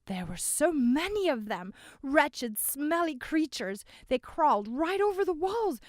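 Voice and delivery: frightened witness. Acted. Frightened witness tells of creatures.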